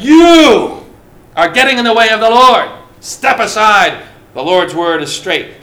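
explosive opening, then forceful preacherly shout; angry rebuke. Acted. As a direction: thunder it at someone blocking your way. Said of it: "He got angry".

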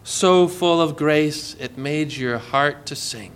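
slow, softening, sinking voice; slow down and let it melt; tender. Real, not acted. Preacher turning from law to grace, tender.

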